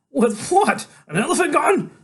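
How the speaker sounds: very high, loud retort; exasperated, sky-high rhetorical question; incredulous cop. A narrator's reading. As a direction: squawk it in disbelief.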